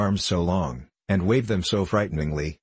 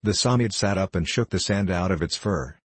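ordinary delivery, nothing marked

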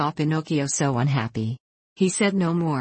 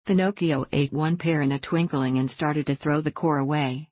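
usual speaking voice

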